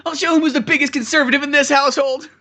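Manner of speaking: loud, high-pitched vow; hammed-up indignant high voice; mock defiant son. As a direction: declare it as a son out to prove Dad wrong. Acting the son vowing to compete.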